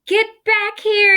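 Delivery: yelling. Acted. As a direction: call them back. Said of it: "yelled Pip".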